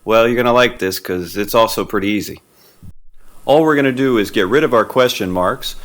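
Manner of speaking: ordinary talk, nothing marked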